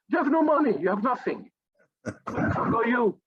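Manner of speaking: high-pitched, incredulous tirade; scornful outburst. Acted. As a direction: cut them down with mocking outrage. Rant at self-important doctors.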